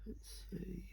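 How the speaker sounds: whispered to himself; breathy muttered whisper. Real, not acted.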